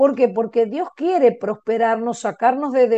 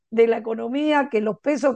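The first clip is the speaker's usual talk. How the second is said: ordinary talk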